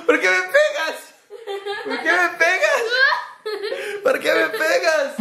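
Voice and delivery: giggling fake complaint; half laughing hurt plea; laughing whine.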